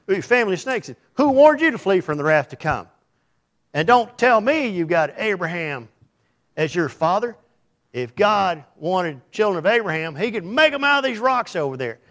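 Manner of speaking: loud, stern denunciation; fiery prophet rebuke. Retold or imitated. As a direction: thunder at the crowd like John the Baptist. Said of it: "He said".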